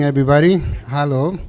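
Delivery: ordinary talk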